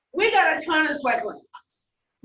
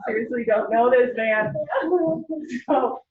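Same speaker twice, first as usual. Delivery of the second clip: usual speaking voice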